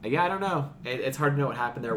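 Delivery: usual speaking voice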